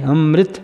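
ਅੰਮ੍ਰਿਤ said "amrit", r blended into m; half r pronunciation. Shown as a demonstration. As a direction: say ਅੰਮ੍ਰਿਤ as am-rit. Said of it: "ਇਸੇ ਤਰ੍ਹਾਂ ਇੱਥੇ ਵੀ".

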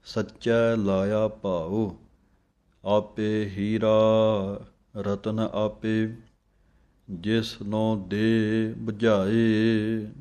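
slow, devout chanting of scripture; measured devotional reading; slow gurbani recitation. Acted. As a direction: recite it slowly like a hymn.

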